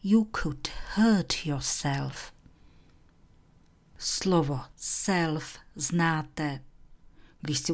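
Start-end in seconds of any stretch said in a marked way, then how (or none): 0.0-2.3 whispered model sentence; whispered example. Shown as a demonstration. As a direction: breathe the phrase gently. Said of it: "A my tu máme vazbu".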